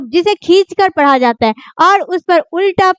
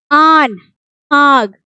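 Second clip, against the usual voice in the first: ordinary delivery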